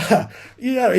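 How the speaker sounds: ordinary talk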